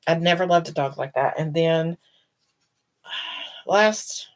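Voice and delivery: usual speaking voice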